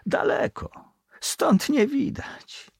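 wistful, half-smiling, slowed at the end; a faraway sigh with a smile; melancholy smile. A narrator's reading. Bosun remembering his distant home, wistful. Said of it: "z melancholijnym uśmiechem dodał".

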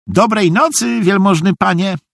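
high, courteous goodnight; high-pitched deferential fool; polite naive simpleton. A narrator's reading. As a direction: bid goodnight innocently in a high voice. Narrator voices Szwejk leaving.

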